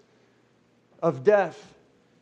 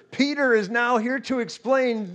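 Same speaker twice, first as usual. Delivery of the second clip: ordinary talk, nothing marked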